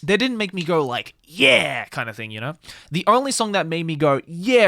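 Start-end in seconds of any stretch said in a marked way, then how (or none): 1.2-1.9 a louder, punchy "yeah"; mock excited cheer. Fan showing what songs didn't make him do, mock excited cheer. 4.2-4.6 higher, louder burst of hype; mock excited cheer. Fan recalling the one song that grabbed him, excited cheer.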